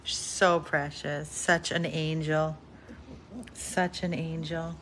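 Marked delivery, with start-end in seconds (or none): none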